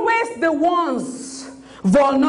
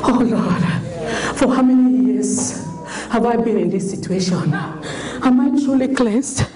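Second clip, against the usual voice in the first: awed healed leper; 7 semitones lower than usual. Acted. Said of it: "Then one of them said".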